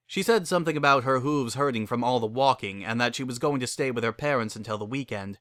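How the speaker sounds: plain reading voice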